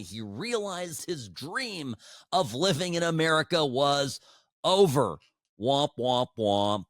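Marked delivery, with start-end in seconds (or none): 5.6-6.8 a hammed-up sad-trombone gag; womp womp. Host mocks the guest's dashed dream with a sad-trombone womp.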